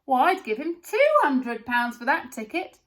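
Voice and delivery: high, loud scornful shout. A narrator's reading. A woman standing close shouts at the tall man. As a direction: shout her down in disbelief.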